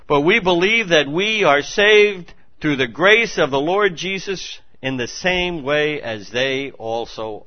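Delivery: loud, then slow emphatic reading; forceful scripture reading. Acted. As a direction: proclaim the verse.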